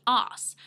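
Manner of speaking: "arse" with a long Australian ah, loud; "arse" said the Australian way, long open vowel; Australian pronunciation. Shown as a demonstration. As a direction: say "arse" with a broad, drawn-out ah and silent r. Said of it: "in Australia, we say".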